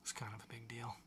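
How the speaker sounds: soft hushed whisper aside; mock whisper. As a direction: whisper it like a juicy secret. Mock-important aside about Spielberg.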